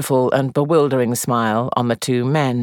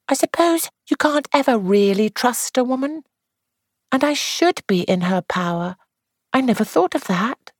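lightly voiced, higher young actress; young woman voice; 12 semitones higher than usual. A narrator's reading. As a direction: play the actress, higher, confiding. Jane voiced high.

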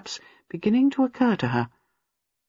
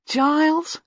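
admiring call; 11 semitones higher than usual. Acted. Gwenda calls husband admiringly.

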